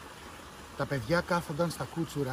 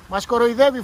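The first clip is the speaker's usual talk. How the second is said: put-on loud and higher, outraged child; louder, higher cry of complaint; indignant shout; 6 semitones higher, 10 dB louder than usual. A narrator's reading. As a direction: yell it out at the others. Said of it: "φώναξε ο Πέτια".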